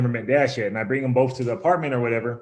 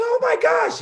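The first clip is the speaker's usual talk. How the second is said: high, squealed falsetto imitation of a panicked woman; mock panicked shriek, very high; mock freak-out; 4 dB louder than usual. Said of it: "she's freaking out".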